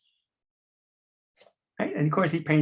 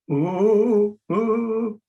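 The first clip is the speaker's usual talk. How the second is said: scared ooh; 6 semitones higher, 6 dB louder than usual. Shown as a demonstration. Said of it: "like that".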